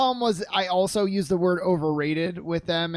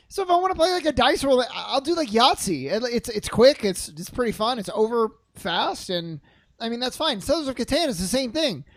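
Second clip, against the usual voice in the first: ordinary delivery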